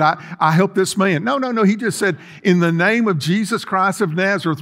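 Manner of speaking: normal talking voice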